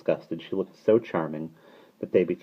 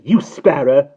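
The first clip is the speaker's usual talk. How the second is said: high, loud outburst; sharp raised exclamation; indignant cry; 9 semitones higher, 11 dB louder, slower than usual. A narrator's reading. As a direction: cry it out as an insult. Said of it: "cried Mary Poppins".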